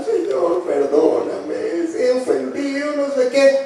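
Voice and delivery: half-hearted sobbing plea for pardon; mock crying plea. King Ahab begging God's pardon, mock crying. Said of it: "Se echó a llorar".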